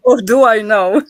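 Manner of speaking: loud, laughing exclamation; laughing agreement. Real, not acted. Wife joking about the unpaid case, loud and laughing. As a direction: blurt it out loudly through laughter.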